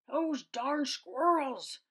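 put-on slow, high, loud old man's shout; angry yell. A narrator's reading. Old man cursing the squirrels, slow loud shout. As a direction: drag it out in a loud, high holler. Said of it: "yelled Old Man Fuquire".

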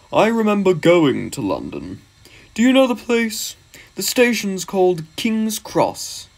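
high, lilting engine character voice; engine reminiscing. Acted. Gordon telling another engine about London, self-important. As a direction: swoop up as the knowing engine.

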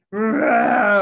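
loud, rough bear roar. A narrator's reading. Old Bear loses the nuts and roars, loud and rough. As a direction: roar angrily at losing. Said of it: "Old Bear roared".

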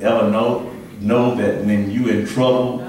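ordinary delivery

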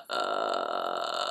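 low creaky fry on ah; vocal fry. Shown as a demonstration. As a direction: rattle the vocal folds, very low. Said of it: "一回やってみるね".

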